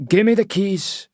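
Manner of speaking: high, menacing Scottish thug; Scottish accent. A narrator's reading. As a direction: demand the keys in a threatening Scottish voice. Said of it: "he says in a Scottish accent".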